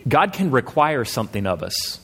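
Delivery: normal talking voice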